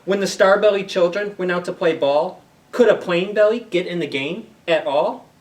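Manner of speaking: lively picture-book narration; storybook reading. Acted. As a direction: play up the rhyme for the kids. Reads Dr. Seuss aloud to kids.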